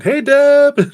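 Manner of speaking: loud, high-pitched call-out; excited greeting. Real, not acted. Streamer shouting out to a channel member, excited greeting. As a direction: holler hello with delight.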